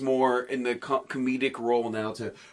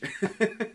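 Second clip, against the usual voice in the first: a high, short burst of laughter; 6 semitones higher, 5 dB quieter than usual. Real, not acted. Speaker laughs after joke.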